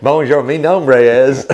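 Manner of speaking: slow, hammed-up Kentucky-twanged mock French; joking about the wife's French, Kentucky accent. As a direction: drag it out in bad Kentucky French. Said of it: "Kentucky dialect of, of French".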